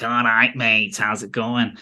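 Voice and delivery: slowed-down Manchester drawl; Mancunian accent. Shown as a demonstration. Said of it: "the Mancunians who are like".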